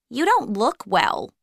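a hint of the watchful boss; lightly voiced supervisor. A narrator's reading. Supervisor noticing Ailish looks unwell, lightly concerned. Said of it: "Miss Fortini said".

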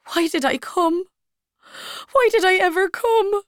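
building tearful outburst; tearful repeating. Acted. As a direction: cry the question out, growing each time. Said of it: "Ailish kept repeating".

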